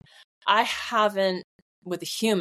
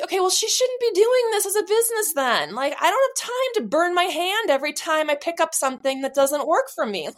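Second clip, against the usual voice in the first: higher, fed-up mock reply; retold impatient comeback, pitched up; exasperated retort; 10 semitones higher than usual. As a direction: brush it off, half laughing at how silly it is. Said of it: "And I was like".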